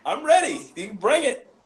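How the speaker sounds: loud, high call of readiness; pumped up. Real, not acted. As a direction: call it out like you welcome the challenge. Hyping himself for hate mail.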